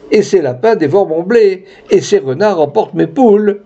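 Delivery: high-pitched, rising list of grievances; lightly acted plaintive villager; aggrieved peasant. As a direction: pile up complaints to someone in power. Peasant complaining to the candidate.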